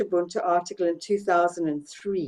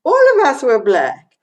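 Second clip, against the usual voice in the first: louder, higher quoted punchline; emphatic retold declaration; 5 semitones higher, 7 dB louder than usual. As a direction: proclaim it as your friend's punchline. Retold punchline amid laughter.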